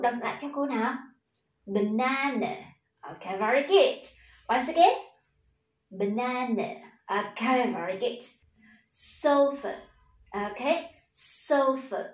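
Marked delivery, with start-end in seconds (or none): 1.7-2.5 banana raised on the middle syllable, then falling; second-syllable stress. Shown as a demonstration. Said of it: "chúng ta sẽ đọc cao giọng hơn". 5.9-6.7 banana raised on the middle syllable, then falling; second-syllable stress. Shown as a demonstration. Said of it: "chúng ta sẽ đọc cao giọng hơn". 8.9-12.0 sofa with stressed "so" and weak short "ơ"; correct English pronunciation. Shown as a demonstration. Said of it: "không đọc là sofa nữa".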